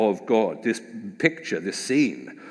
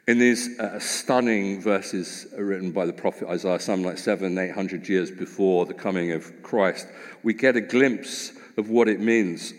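ordinary delivery

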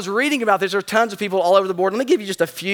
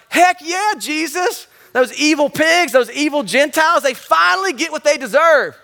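higher, mock-gleeful cheering on the punishment; gleeful onlooker; 7 semitones higher than usual. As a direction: play a delighted bystander cheering, pitched up.

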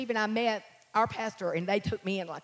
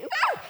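short high-pitched woo; high whoop. Real, not acted. Worship leader mid-chorus, high whoop. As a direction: let out a praise whoop.

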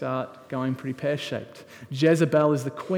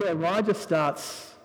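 higher, louder preaching that builds as the story goes; full-force preaching; 5 semitones higher, 6 dB louder than usual. Acted. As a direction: preach it out to the congregation with rising fire. Preaching Elijah showdown at full force.

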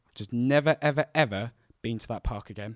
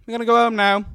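put-on loud, high mum calling her child; 11 semitones higher, 14 dB louder than usual. Mum calls her son to go home, loud high calling out. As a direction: holler it from far off. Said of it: "then my mum calls".

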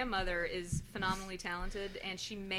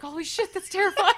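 shocked exclamation; 11 semitones higher, 9 dB louder than usual. Retold or imitated. Retelling shock at the video.

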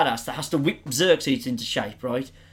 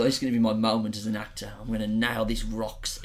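hushed inner thought; 8 semitones lower, 7 dB quieter than usual. Retold or imitated. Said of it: "in his head, he thought".

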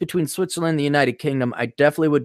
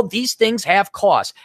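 usual speaking voice

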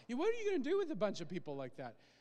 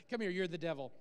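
usual speaking voice

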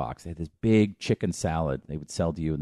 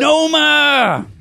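shout; 9 dB louder than usual. Pretend. Mock commercial yell.

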